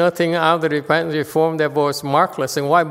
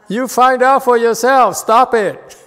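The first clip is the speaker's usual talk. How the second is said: loud, higher scolding through laughter; laughing scold; 7 semitones higher, 7 dB louder than usual. Real, not acted. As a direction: scold them loudly while laughing. Joking scolding amid laughter.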